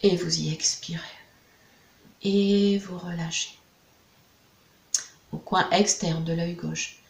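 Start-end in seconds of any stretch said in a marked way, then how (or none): 0.0-1.0 a hushed exhale guide; whispered breathing cue. Acted. Guide leading a client through a breath, whispered.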